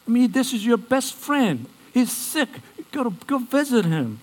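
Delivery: high-pitched, baffled protest; confused disciples. Acted. Disciples urging Jesus to visit his sick friend, confused. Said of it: "the disciples were confused".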